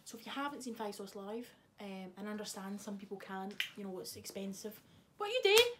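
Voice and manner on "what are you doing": high, loud outburst; exasperated scolding. Real, not acted. Vlogger to friend interrupting the video, loud and exasperated.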